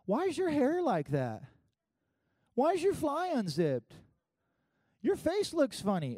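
put-on high, slow, nosy child voice; blunt little kid. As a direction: ask it like a tactless toddler. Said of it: "It's just like".

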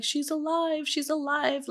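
a touch of his tearful disbelief; mild mock breakdown. Retelling the suspect breaking down in interview, mildly tearful. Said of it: "he breaks down".

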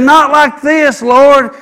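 protesting Peter. Acted. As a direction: cry out to stop him.

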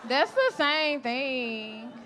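very slow, laughing, each word drawn out on "same"; slow emphatic repeat. Retold or imitated. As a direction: spell it out slowly, laughing.

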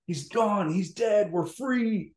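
high-pitched retold jubilant cry; euphoric. Uncle bringing mom news of the dictator's fall, euphoric. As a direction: imitate overjoyed news, pitch climbing with each phrase. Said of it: "like, euphoric".

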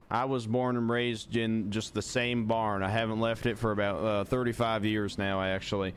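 put-on polite Southern accent, deadpan; country Southern accent. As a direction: play a courteous Southern country fellow. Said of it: "I'm stuck in this accent now".

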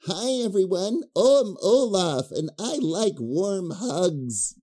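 high, slow, sweet snowman voice; a dragged-out cartoon snowman greeting; Olaf impression. A host doing a cheesy Olaf voice for his co-host, high and slow. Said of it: "humiliated by my own mouth".

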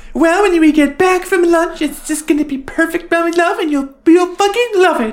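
very high, sweet cooing voice; high-pitched Julie voice. Retold or imitated. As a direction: coo it like a doting wife. Quote of Julie.

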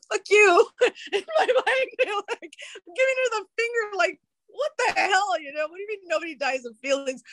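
high-pitched, giggly mock indignation; laughing. Real, not acted. Client's silent retort to her sober coach, laughing in mock outrage. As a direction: laugh through the retold retort, voice way up.

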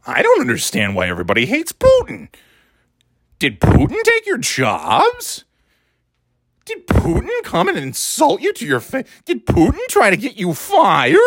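mocking voice swooping up into high squeaks; squeaky Tucker Carlson. Podcaster parodying a TV host defending Putin, squeaky. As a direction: whine it with squeals on the key words. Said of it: "Squeaky boy".

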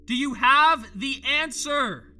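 loud, high, slow plea; pleading shout. Acted. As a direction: beg for the answer out loud. Asks, then repeats louder.

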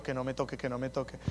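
low, soft nervous muttering under the breath; nervous mumble. Retold or imitated. A nervous believer softly dreading his turn to pray aloud. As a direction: mutter it, hoping not to be picked. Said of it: "me sentía como un bobo".

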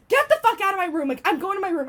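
loud, high outraged shout; retold angry yell. Girl ordering people out of her room, retold angry shout. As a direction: reenact ordering everyone out. Acted yelling in retold fight.

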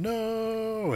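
high, long-held mock wail of doom; mock drawn-out cry. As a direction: stretch it out high, imitating a doomed movie guard. Mimics film gag's doomed guard.

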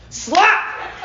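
high, sharp "Slap!"; sudden slap exclamation. Acted. Preacher acts out a brother's slap, sudden high exclamation. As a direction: punch the word out high, like a sound effect.

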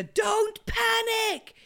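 very high, long drawn-out cry; mock panic call. Host acts out a "don't panic" talk, high drawn-out mock alarm. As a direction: stretch each word high and slow. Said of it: "type conversation there".

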